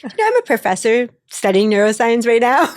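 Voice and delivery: half-laughing as she tells him she studies neuroscience too; laughing disbelief. Retold or imitated. Woman laughing while telling a date she's a neuroscience professor.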